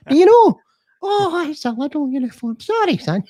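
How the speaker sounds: very high put-on woman's voice; apologetic shopper woman. Shopper excuses mistaking him for staff, high mock-apologetic woman's voice. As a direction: squeak it high, starting loud, then apologise. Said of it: "She's like".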